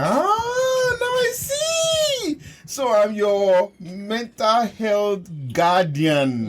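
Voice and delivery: hugely high, drawn-out cry of discovery, easing down slowly; slow, hammed-up dawning in a very high voice; mock realisation. Acts out a sudden mock realisation.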